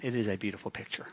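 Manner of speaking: ordinary delivery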